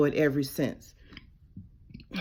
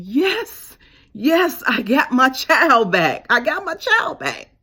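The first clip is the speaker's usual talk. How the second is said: high, overjoyed cry of welcome; a father's delight at a child come home; joyful God voice; 7 semitones higher, 5 dB louder, slower than usual. Retold or imitated. God rejoicing that his child came back, overjoyed.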